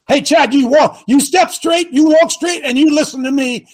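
high, loud barked orders; drill sergeant. Acted. Ordering Chad like recruit.

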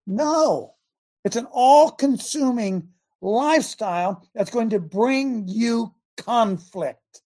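ordinary delivery

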